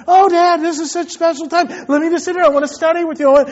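put-on high, eager child pleading; imitated beseeching. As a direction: coax Dad like a wheedling kid. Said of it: "the good word is beseech".

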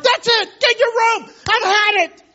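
mock enraged father yelling; furious earthly father. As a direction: rant like a fed-up father sending a kid away. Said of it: "He's not like".

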